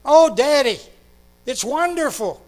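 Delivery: high, loud, bursting with joy; a child rushing in with big news; excited little girl. Retold or imitated. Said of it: "with a great big smile on her face".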